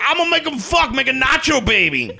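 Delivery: high, loud mock chef voice; a hammed-up chef bellowing; Guy Fieri impression. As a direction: ham it up like a TV chef. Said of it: "It's like".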